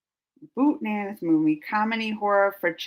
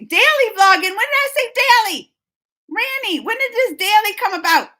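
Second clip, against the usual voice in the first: louder, higher mock outrage; mock indignation; 11 semitones higher, 9 dB louder than usual. Real, not acted. Reacting to chat's daily demand, mock outraged. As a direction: protest playfully at the chat.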